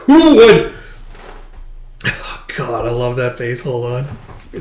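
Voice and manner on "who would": high, loud, furious cry; much higher and louder, furious; furious outburst. Retold or imitated. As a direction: act out a loud flash of fury. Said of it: "she just furious is like".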